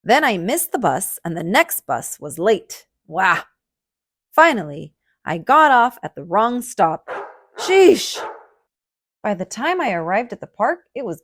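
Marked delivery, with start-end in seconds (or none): 3.1-3.4 mock wail. A narrator's reading. Missed the bus. 7.6-8.2 loud, high fed-up exclamation; exasperated sheesh. A narrator's reading. Wrong stop.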